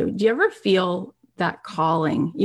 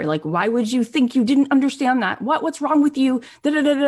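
higher-pitched mock berating, piling up questions; exasperated scolding; 6 semitones higher than usual. Studio guy berating her over models, exasperated retold scolding. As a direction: imitate someone laying into her.

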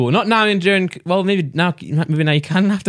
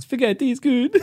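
mock Italian accent; 8 semitones higher than usual. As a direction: shout it through laughter like a cartoon chef. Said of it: "You're turning Swedish".

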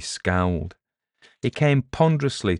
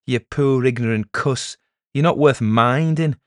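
raised, condescending pity; mock-kindly pity; 6 semitones higher, 6 dB louder than usual. A narrator's reading. Elder talking down to the beaten peddler, kindly. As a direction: pity him from on high. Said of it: "said Charlesy kindly".

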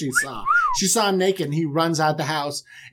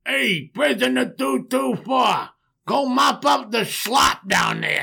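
higher, slow called-out order; hammed-up jailer yelling; bossy guard; 7 semitones higher, slower than usual. Guard calling a prisoner to mop, mock shout. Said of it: "They're like".

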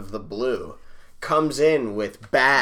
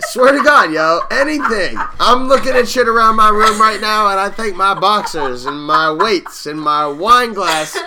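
excited; 8 semitones higher, 10 dB louder than usual. Real, not acted. Hyped laughing rant about meth.

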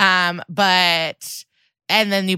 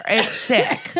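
ordinary talk

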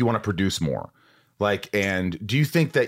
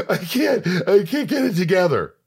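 high gasping mock sob, voice cracking; mock ugly cry; 8 semitones higher than usual. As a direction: act out falling apart in tears, hammed up. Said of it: "kind of cry".

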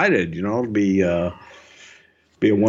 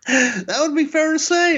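laughing; 13 semitones higher than usual. Real, not acted. Laughs about being busy.

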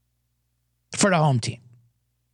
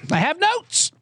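mock proud; 12 semitones higher, slower than usual. Real, not acted. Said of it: "proud of notes".

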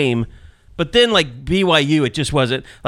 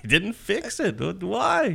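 quiet, softer exasperated grumble; 4 semitones higher, 7 dB quieter, slower than usual. Retold or imitated. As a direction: mimic a fed-up, baffled sigh. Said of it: "exasperated George, like, he's just like".

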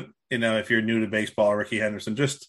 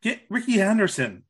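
high, eager call for Ricky; excited; 9 semitones higher than usual. Real, not acted. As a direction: pitch it up with glee. Excited suggestion.

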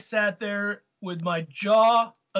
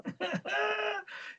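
laugh; 11 semitones higher, 8 dB quieter than usual. Real, not acted. Laughing at listener question.

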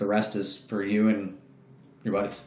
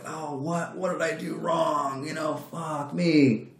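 high, slow, half-shouted disbelief; mock dismay; 7 semitones higher, slower than usual. Told he lost the contract, hammed-up dismayed shout. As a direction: play up the shock of losing.

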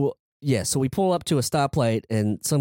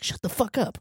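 high, softer mock scolding; retold hushing; 10 semitones higher, 4 dB quieter than usual. Friends to the guy screaming back, retold high-pitched scolding. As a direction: imitate friends urgently shushing you, high-pitched. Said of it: "you guys like".

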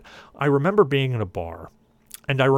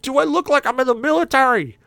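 much higher, louder, stressing like; indignant question; 14 semitones higher, 6 dB louder than usual. Retold or imitated. Passenger shows cabbie his ponytail, indignant high demand. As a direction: hold up your ponytail and demand it.

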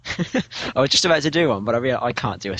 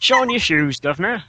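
put-on high, louder Cockney accent, rising at the end; Cockney shoeshine; 6 semitones higher, 5 dB louder than usual. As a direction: call out like a Cockney street hawker. Briton plays Cockney shoeshine.

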